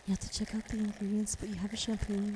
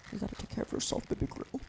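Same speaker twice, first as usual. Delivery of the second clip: whispered mock-caring roleplay; teasing pampering whisper; ASMR whisper. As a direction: whisper it sweetly close in.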